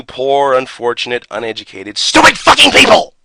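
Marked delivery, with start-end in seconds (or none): 2.0-3.1 sudden much higher and louder outburst; mock-furious shout. Real, not acted. Insulting viewers who skip manuals, mock-furious shout. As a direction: snap the insult at the audience. Insult after building list.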